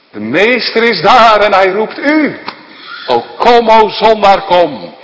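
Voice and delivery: loud, high calling out to sinners; preached invitation. Acted. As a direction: call the sinner home with full force.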